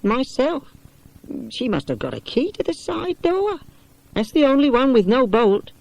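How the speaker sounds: put-on high, worried voice of an old companion; fussy companion. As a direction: fret about the locked doors. Companion tells of break-ins.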